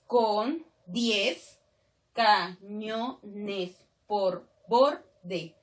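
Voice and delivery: very slow, a gap after every syllable; deliberate scansion, counting out each syllable. Shown as a demonstration. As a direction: chop the verse into separate syllables. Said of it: "Si yo separo nada más".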